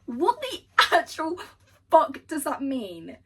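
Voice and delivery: loud high swooning yell; exasperated outburst. Real, not acted. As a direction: shriek in disbelief. Overwhelmed by romantic line.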